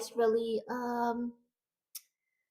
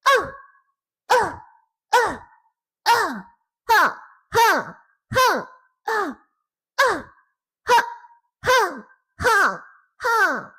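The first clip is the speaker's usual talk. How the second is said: Hannah; 8 semitones higher, 8 dB louder, slower than usual. Shown as a demonstration. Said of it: "I'm gonna go ahead and attack".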